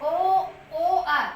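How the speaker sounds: ordinary talk, nothing marked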